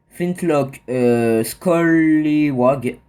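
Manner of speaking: "Scollywag" drawn out very slowly in a jokey English accent; slow, hammed-up English accent; mock English accent. Shown as a demonstration. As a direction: stretch the name out in a proud fake English accent. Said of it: "avec mon accent génial".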